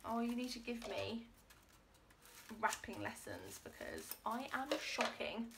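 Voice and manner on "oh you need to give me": ordinary talk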